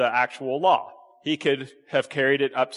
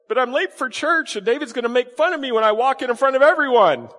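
rising, pleading protest getting louder; whiny excuse; 9 semitones higher, 6 dB louder than usual. Retold or imitated. Churchgoer protesting to soldier, rising whine. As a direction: beg off like a late churchgoer.

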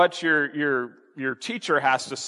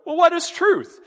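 higher-pitched, offhand retort as Pilate; dismissive Pilate; 12 semitones higher, 4 dB louder than usual. Retold or imitated. Pilate brushing off Jesus before walking out, mock dismissive. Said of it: "then he just walks out".

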